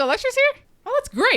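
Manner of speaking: high, loud delighted reaction; excited recognition. Retold or imitated. Host retelling her reaction to the actress appearing, excited. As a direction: pitch leaps up in happy surprise. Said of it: "Yeah, I was like".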